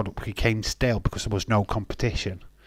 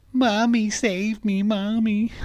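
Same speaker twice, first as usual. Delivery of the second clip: high mock plea of a mummy's boy; Dominic; 11 semitones higher, slower than usual. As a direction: wail mockingly for mummy, pitched way up. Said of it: "Hugging his mommy".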